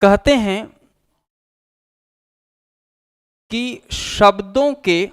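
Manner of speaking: teacher dictating notes, one phrase at a time; dictation pace. Shown as a demonstration. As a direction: read it out phrase by phrase for students to write down. Dictating the definition of Sangam.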